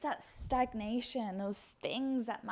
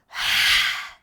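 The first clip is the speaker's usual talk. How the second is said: big loud sigh through the mouth; forceful sigh; 15 dB louder than usual. Shown as a demonstration. Teacher exhaling with the class, loud forceful sigh. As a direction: push the breath out hard. Last breaths of the practice.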